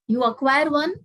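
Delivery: ordinary talk, nothing marked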